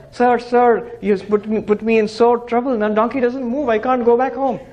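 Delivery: distressed complaint. Acted. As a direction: rush in upset and beg for help.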